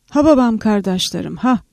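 usual speaking voice

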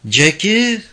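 high-pitched searching shout, slightly restrained; calling out. A narrator's reading. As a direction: call out to someone hidden in the dark. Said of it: "yanlış yöne doğru bağırmıştı".

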